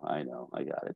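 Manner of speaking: softly cutting her off; a low, hushed I got it; quick knowing reassurance. Retold or imitated. Husband reassuring his wife before she finishes, quiet.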